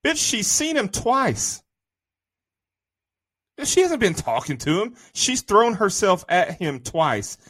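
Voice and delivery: high-pitched, drawn-out disbelief; exasperated objection, stretching the words; indignant. Real, not acted. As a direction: protest it slowly, pitch jumping up. Indignantly disputing a film's storyline.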